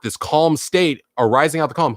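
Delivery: ordinary delivery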